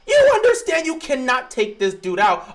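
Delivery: usual speaking voice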